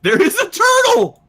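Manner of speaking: excited shout. Real, not acted. Excited spotting turtle.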